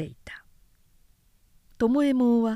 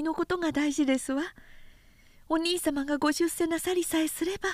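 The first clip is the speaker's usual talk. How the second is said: put-on higher, gentle young woman pleading; tender younger sister, higher voice, hushing the end; young sister's voice; 8 semitones higher than usual. Sister Sayo pleads with brother.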